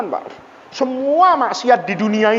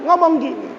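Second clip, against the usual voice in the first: ordinary delivery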